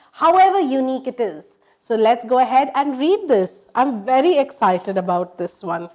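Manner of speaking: usual speaking voice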